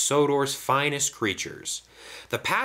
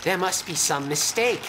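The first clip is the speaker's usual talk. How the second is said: higher-pitched, mildly shocked gasp; gasped dismay; 7 semitones higher than usual. A narrator's reading. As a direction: gasp it in rising alarm, lightly acted. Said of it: "gasped Toad".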